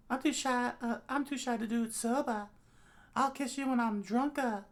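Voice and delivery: very high character voice; high-pitched flirty refusal; shy drinking companion. Acted. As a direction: play a shy figure in a falsetto-high voice. Plays Himeno in story.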